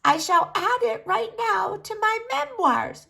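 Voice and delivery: ancient granny dreaming of her memoirs; proud old granny. Acted. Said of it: "she said".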